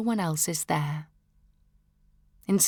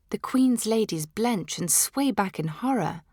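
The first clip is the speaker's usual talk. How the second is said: plain reading voice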